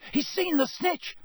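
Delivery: very high, excited shout; Harry shouting. A narrator's reading. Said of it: "Harry shouted".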